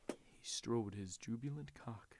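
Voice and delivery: slow, low mock read-aloud; erotic novel read-aloud. Reading erotica aloud behind an old lady, slow mock narration. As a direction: recite it slowly like a racy audiobook. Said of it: "read Fifty Shades of Grey out loud".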